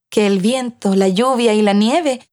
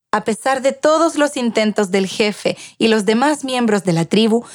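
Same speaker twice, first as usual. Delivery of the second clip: usual speaking voice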